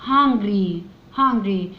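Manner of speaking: hungry stretched out slowly, sound by sound; word modelled slowly. Shown as a demonstration. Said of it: "ندخل على ال R ووراها ال Y". (نطق hungry ببطء)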